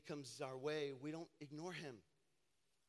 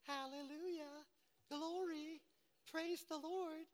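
mock the fake Christians' hallelujahs, pitched up; mock pious praise; slower than usual. Mocking masked churchgoers' fake praise.

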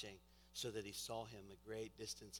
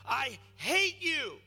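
slow, loud, high outburst of an acted son; telling off; 14 semitones higher, 16 dB louder, much slower than usual. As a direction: hurl each word at mom. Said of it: "telling his mother off".

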